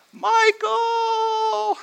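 very high, loud, drawn-out scream; panicked cry. Retold or imitated. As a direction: shriek the name up the cliff for help. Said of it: "she cried out".

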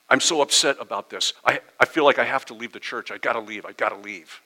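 mock distressed, rushing it; a touch of quivering panic; upset caller. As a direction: play the upset parishioner calling late at night. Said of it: "your voice is quivering, all upset".

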